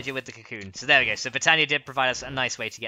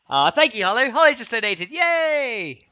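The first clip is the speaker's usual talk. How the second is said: excited; 11 semitones higher, 8 dB louder, slower than usual. Real, not acted. Thanking a donor, cheering.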